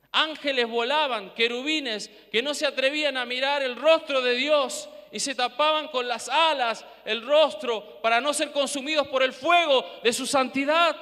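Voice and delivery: loud, fervent preaching. Acted. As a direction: build it up to a shout. Preacher building to a shout.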